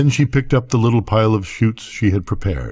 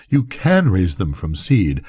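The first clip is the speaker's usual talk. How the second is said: ordinary delivery, nothing marked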